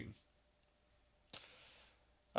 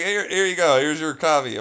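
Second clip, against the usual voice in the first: louder, higher, fed-up mock delivery; mock exasperated handover; 5 semitones higher, 8 dB louder than usual. Imitating himself handing over the coffee, fed up. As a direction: shove the coffee at them, done with it. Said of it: "but it's like".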